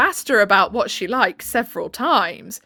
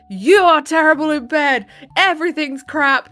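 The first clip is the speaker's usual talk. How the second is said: louder, blunt mock harshness; harsh blunt complaint; 4 semitones higher, 6 dB louder than usual. Mock-harsh partner blurting out a bedroom complaint. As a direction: spit it out rudely. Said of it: "isn't really super".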